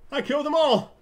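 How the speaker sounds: loud, high triumphant yell; triumphant shout. Real, not acted. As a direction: crow over the win. Triumph after fight.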